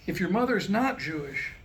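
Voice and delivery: ordinary talk, nothing marked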